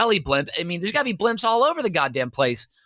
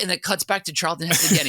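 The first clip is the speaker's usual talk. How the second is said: usual speaking voice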